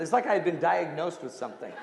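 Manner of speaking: usual speaking voice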